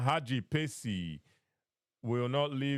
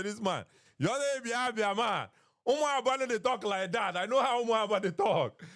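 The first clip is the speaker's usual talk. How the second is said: mock-scornful, pitched higher, calling out the accent; retelling his own sceptical reply; 8 semitones higher than usual. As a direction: replay your doubtful challenge with a raised voice. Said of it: "I say".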